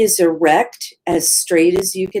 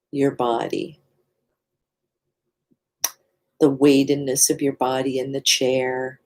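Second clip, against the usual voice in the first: guided meditation. Real, not acted. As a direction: lead listeners into body awareness, leaving space between phrases. Leads a mindfulness practice.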